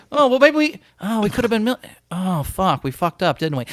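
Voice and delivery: mock dawning regret, starting high and sinking; bravado gone. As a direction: play a sheepish realization, trailing down. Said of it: "this bravado goes away once it's over".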